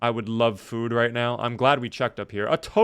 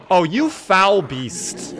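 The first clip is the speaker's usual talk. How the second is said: slow, high, louder theatrical scorn; mock outrage; 6 semitones higher, 5 dB louder, much slower than usual. Real, not acted. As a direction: drag out the insult like a stage villain. Cursing the zombie that ambushed him.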